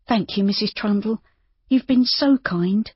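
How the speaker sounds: lightly voiced young woman, grateful. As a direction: thank her warmly and shyly. Judy thanks her.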